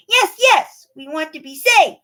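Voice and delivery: high, loud eager chorus; the animals rushing to agree. A narrator's reading. Farm animals eagerly agreeing, high and loud.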